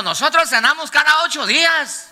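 más agudo, jactándose de su piedad; presunción imitada. Retold or imitated. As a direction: imita al que se golpea el pecho orgulloso. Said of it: "se golpean el pecho diciendo". (burla del creyente que presume de cenar cada ocho días)

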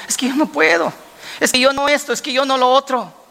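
high-pitched string of excuses; protesting Moses. Acted. Moses tells God he cannot, protesting. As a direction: act out a reluctant man's protest.

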